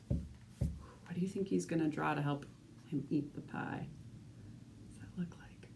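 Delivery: hushed whispered question. Real, not acted. Asking a child in a whisper what comes next. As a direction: lean in and whisper the secret.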